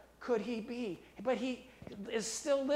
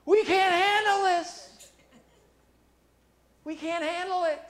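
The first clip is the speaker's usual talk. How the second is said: overwhelmed crowd, hammed up; mock crowd panic; 7 semitones higher, 9 dB louder than usual. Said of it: "and they're going".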